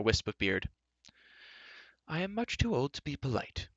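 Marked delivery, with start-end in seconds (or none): 2.1-3.7 put-on high-pitched old man's voice; old enchanter, brusque. Old enchanter opens the council, brusque. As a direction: address them in a high, aged voice.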